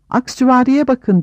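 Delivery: high, lightly shouted call; mild excited shout. A narrator's reading. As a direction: call out high, without full force. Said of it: "diye bağırdı Aragorn".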